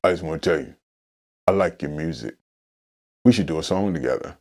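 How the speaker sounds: imitating a man's very deep, soft speech; real deep voice. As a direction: sink to the bottom of your range, quietly. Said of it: "he got this real deep voice".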